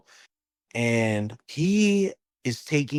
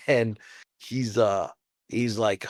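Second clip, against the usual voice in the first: usual speaking voice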